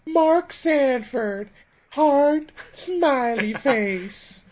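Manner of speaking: very high, slow mock girly voice. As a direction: coo the sign-off in a sugary falsetto. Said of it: "and I was like".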